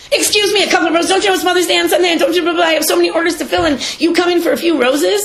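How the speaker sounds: hammed-up belligerent shopkeeper, fast and shrill with outrage; imitating angry yell. Mother retelling a florist yelling at her, mock angry. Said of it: "she starts yelling at me".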